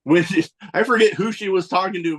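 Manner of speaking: ordinary talk, nothing marked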